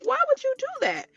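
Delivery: high incredulous mock whine; exasperated disbelief. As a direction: squeal out the question like you can't believe him. Quoting her own exasperated reply.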